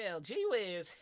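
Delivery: hammed-up old-fashioned American exclamation; gee-whiz American accent. Shown as a demonstration. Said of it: "what's your best American accent?".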